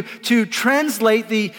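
ordinary delivery

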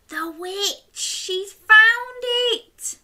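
high, slow, thrilled reveal; excited. Real, not acted. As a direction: announce it with big wonder.

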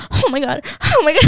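high, gasping re-enacted panic; mock hysterical gasps, climbing in pitch; freaking out. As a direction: act out a rising freak-out. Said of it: "I start freaking out".

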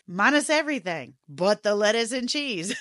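loud, breaking into laughter; incredulous teasing. Retold or imitated.

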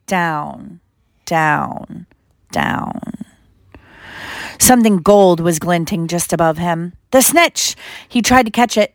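usual speaking voice

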